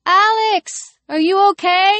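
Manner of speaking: put-on high young girl's voice; high, concerned call; worried girl. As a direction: ask with anxious concern. Worried girl calls fainting Alex.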